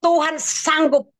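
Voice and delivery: high, drawn-out cry of faith, partly held back; retold hysterical cry. Mother crying out to God beside her labouring child, retold hysterical cry. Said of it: "saya udah teriak histeris dan saya katakan".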